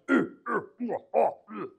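high, tight wrestling grunts; mock straining grunts mid-wrestle. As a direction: grunt like you're in a hold. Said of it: "grunts and groans and moans and strains".

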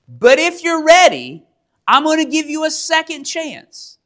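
high-pitched mock king giving a warning; angry king. King offering three defiant men a second chance, restrained anger. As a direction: play the king holding anger in, voice lifted. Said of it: "So he's furious".

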